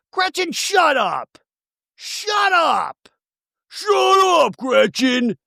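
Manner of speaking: hammed-up screech to silence someone; mock furious yelling. [name] telling Gretchen to shut up, mock furious.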